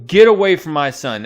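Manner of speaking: loud protective shout at the intruder; yelling. Retold or imitated. Mother shouting at Magneto to stay away from her son. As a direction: yell it to drive him off. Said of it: "Just yelling".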